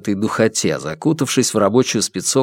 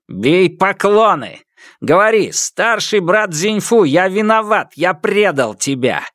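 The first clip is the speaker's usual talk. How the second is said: commanding dictation; 9 semitones higher than usual. Acted. Kidnapper dictates humiliating apology.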